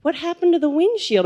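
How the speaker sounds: surprised mom. Retold or imitated. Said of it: "she goes".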